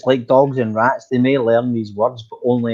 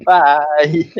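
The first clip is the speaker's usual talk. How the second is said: mock cheerful goodbye; 3 semitones higher, 5 dB louder than usual. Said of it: "everybody waved to her as we went past, going".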